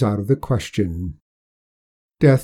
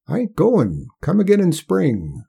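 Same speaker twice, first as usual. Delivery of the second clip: put-on higher, firm old-timer refusal; stubborn old man; 7 semitones higher than usual. Old Hark voiced by narrator.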